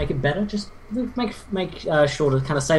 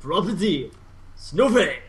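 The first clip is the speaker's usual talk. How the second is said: lightly voiced hype announcer, name held high; GSL announcer voice; 3 semitones higher, slower than usual. Caster imitating GSL intro for the team. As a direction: announce the player like a big tournament. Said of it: "What'd you think?".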